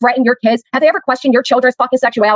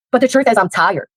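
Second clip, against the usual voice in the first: ordinary talk, nothing marked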